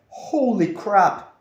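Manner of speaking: retold shock at full volume; shocked outburst. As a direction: blurt it out in appalled disbelief, stretching the first words. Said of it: "I was shocked".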